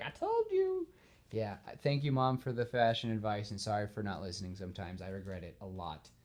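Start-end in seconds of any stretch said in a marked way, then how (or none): 0.0-0.9 much higher, sing-song told-you-so mom; smug mom. Retold or imitated. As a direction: tease like a mom who was right. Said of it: "And your mom's like".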